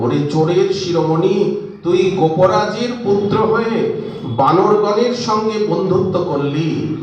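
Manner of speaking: high-pitched acted rebuke; mother's exclaiming reproach; scolding mother. A narrator's reading. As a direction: berate the child with indignation, pitched well up. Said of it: "জননী যখন তিরস্কার করছিলেন".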